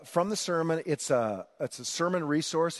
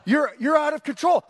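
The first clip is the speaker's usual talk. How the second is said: higher, louder exasperated warning; scolding wife, pitched up; 10 semitones higher, 6 dB louder than usual. Retold or imitated. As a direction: rein in a husband losing it. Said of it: "She's like".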